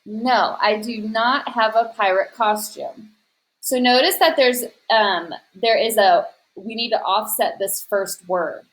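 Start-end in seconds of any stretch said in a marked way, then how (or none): none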